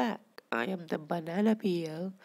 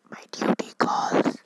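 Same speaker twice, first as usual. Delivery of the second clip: mock dramatic exit; 10 dB louder than usual. Leaving mid-video.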